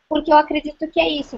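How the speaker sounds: usual speaking voice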